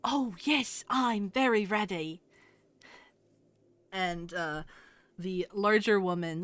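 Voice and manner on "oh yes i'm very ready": higher, bright eager answer; eager young tailor. Acted. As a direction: chirp it like a shy kid called up.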